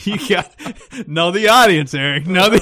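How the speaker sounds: loud, high mock reprimand; teasing scolding. Real, not acted. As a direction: call him out loudly amid laughter. Mock scolding amid laughter.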